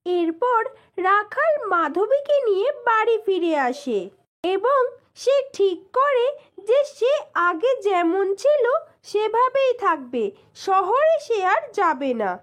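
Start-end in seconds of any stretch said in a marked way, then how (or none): none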